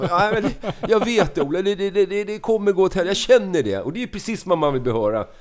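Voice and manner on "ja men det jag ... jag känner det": high, stammering insistence; mock panic. Nervous flyer telling Ola it will end badly, high-pitched mock panic. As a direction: play a nervous flyer sure of disaster. Retold panic before take-off.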